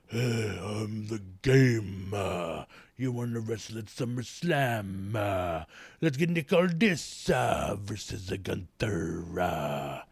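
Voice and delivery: mocking wrestler promo voice, slow and stretched; Triple H impression. Host mocks Triple H booking the match, slow mock promo. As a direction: ham up a heel's swaggering promo. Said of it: "the Triple H coming in saying".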